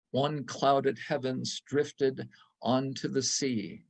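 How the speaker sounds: ordinary delivery, nothing marked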